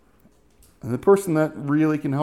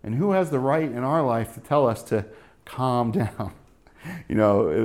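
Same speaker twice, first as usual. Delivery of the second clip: ordinary talk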